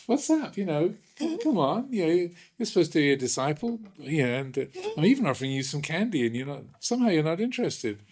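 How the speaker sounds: hammed-up mock scolding; teasing teacher. Teacher taunting disciple.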